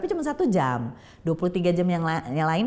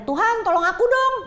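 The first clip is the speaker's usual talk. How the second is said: put-on high, loud child's cry for help; child's shout; 13 semitones higher, 5 dB louder than usual. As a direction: call out to God at the top of your voice. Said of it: "tau-tau dia teriak aja". (teriakan anak yang tinggi dan nyaring)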